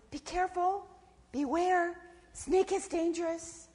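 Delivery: high-pitched warning; little inner voice. A narrator's reading. Little voice to scared possum, high careful warning. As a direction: caution slowly in a small high voice. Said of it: "the little voice said".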